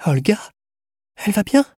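high, rising, startled questions; surprised. A narrator's reading. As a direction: ask in sudden, hopeful surprise. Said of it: "Tanusha fut surprise".

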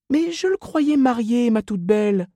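very high put-on woman's voice; puzzled saleswoman. A narrator's reading. Miss Inez thought the son married, puzzled. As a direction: question her in high gossipy surprise.

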